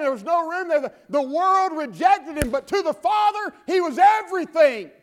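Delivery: full-voiced preaching shout. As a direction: build to a shouted climax.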